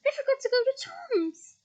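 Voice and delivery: high voice, panicking over a missed class; high, dismayed panic; high panicked. A narrator's reading. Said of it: "Hermione squeaked".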